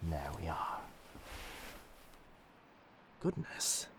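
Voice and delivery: soft whisper, close and caring; whispered concern. Acted. Pirate captain whispering over his feverish captive, hushed. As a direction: breathe it out quietly as you check her fever.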